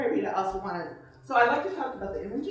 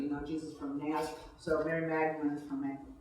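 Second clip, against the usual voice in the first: plain reading voice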